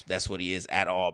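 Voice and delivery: usual speaking voice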